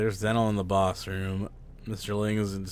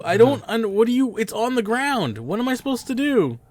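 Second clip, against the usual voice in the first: flustered pleading; 13 semitones higher, 7 dB louder than usual. Real, not acted. Confused, pleading with teammates.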